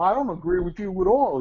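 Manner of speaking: a scared kid standing his ground, lightly voiced; firm refusal. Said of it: "he just says".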